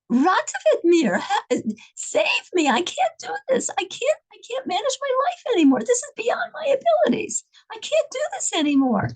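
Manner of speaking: very high, breaking plea for rescue; desperate plea. Retold or imitated. Begging to be saved.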